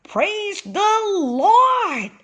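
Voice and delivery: high, slow, ringing shout of joy; a father's joyous cry; joyful shout. Acted. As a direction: throw the praise up to the cave roof. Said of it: "shouted Father Ox joyfully".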